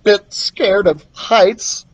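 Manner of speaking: hesitant, slow and nervous confession; a touch of nervous boy voice, dragging the words. Acted. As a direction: admit it while gulping with nerves. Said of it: "he said nervously".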